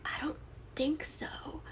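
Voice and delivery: put-on small, slow whisper of a scared kid; scared kid's whisper; timid kid. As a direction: answer softly like a frightened child. Scared kid answers the doctor.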